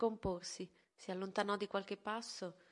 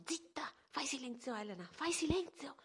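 acuto, concitato ma trattenuto; zittire spaventata; 7 semitones higher than usual. A narrator's reading. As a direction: zittiscila in fretta, col panico. Madre zittisce la figlia spaventata. (madre terrorizzata che zittisce la figlia)

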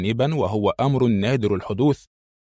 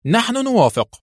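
much higher-pitched eager answer; quick, eager reply; 13 semitones higher than usual. A narrator's reading. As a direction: jump in at once to agree. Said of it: "أجابها الإسرائيلي في سرعة".

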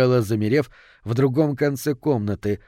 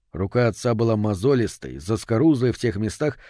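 ordinary delivery

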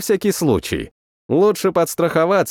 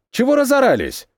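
higher, sharp call to order; silencing snap; 10 semitones higher than usual. A narrator's reading. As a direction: cut the noise. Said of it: "Потушил я гомон".

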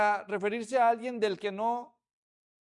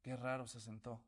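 much softer, low gossiping murmur; curious murmur; 9 semitones lower, 15 dB quieter than usual. Retold or imitated. Onlookers gossiping about Boaz sitting down, hushed murmur. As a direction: mutter it to your neighbor. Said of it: "gente diciendo".

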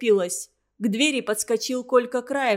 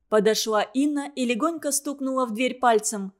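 plain reading voice

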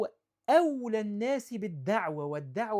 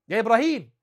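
loud, curt cry of the name; harsh call; 3 semitones higher, 12 dB louder, faster than usual. Retold or imitated. Father coldly calling out his son, loud and harsh. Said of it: "مالوش يا بني حتى".

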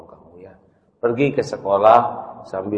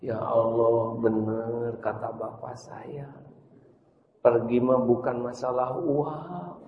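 tearful whisper; 8 dB quieter, slower than usual. Acted. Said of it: "berlinang air mata".

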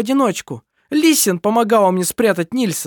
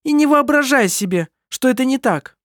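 spiteful taunt; 12 semitones higher, 4 dB louder than usual. A narrator's reading. Kerstin spites Anna-Maria.

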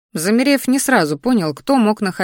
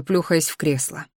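plain reading voice

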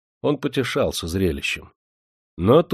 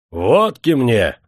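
loud, slow courier's bellow; shouted demand; 3 semitones higher, 6 dB louder, much slower than usual. A narrator's reading. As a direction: shout it across the whole room. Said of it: "он закричал на всю избу". (крик на всю избу)

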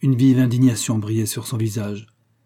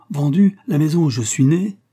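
stunned disbelief; 5 semitones higher, 5 dB louder than usual. Acted. Mainville reels at the sale.

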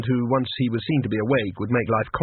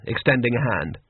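ordinary delivery

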